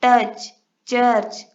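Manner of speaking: "टच, church" drawn out slowly and loud, stressing the ch; teacher stretches "टच, church" for students; slow demo words. Shown as a demonstration. Teacher to students, slowly sounding out the ch words. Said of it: "चे का sound देते हैं".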